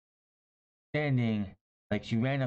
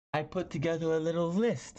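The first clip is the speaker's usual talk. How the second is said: much higher, put-on smug Jericho voice; Chris Jericho impression; 11 semitones higher than usual. Recapping a wrestling show, quoting the champion in a put-on high smug voice. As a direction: play the smug champion reading from his clipboard. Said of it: "he says".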